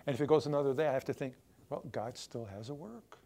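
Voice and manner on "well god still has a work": resigned inner voice. Retold or imitated.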